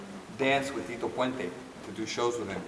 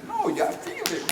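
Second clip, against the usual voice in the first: high insistence. Retold or imitated. Insisting dance is felt.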